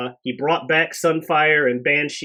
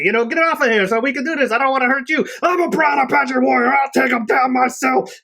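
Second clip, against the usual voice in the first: high, loud, fast hammed-up boast; mock tough warrior; 12 semitones higher, 6 dB louder than usual. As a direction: brush him off like a stubborn hero. Thunderbird answers Banshee, acted.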